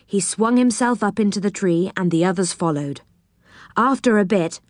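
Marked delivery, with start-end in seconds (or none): none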